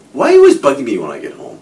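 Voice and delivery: loud, fast irritated snap; prickly defensiveness. Retold or imitated. Dave snaps defensively at his wife when he gets home. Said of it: "prickly defensiveness".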